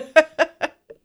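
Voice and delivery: high, loud burst of laughter. Real, not acted. Amused at co-host's dry answer.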